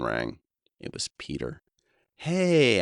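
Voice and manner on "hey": bright, much higher, louder upbeat greeting; enthusiastic hey. Retold or imitated. Answering the phone, with a bright upbeat hey. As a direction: chirp it like a cheerful social persona. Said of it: "I said enthusiastically".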